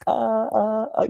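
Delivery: put-on very high cooing sounds for a baby; playful baby-talk babble. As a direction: coo up high to amuse an infant. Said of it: "if it's a baby and I'm going".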